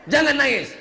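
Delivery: loud, high, emphatic command; firm shouted order. Acted. Preacher coaching a parting mother not to cry, forceful.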